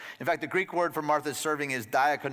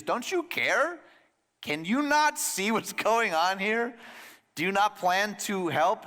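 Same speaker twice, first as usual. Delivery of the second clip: high, slow, exasperated complaint; exasperated plea; 7 semitones higher, slower than usual. Acted. Martha complaining to Jesus, high and drawn out. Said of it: "she says what the disciples say".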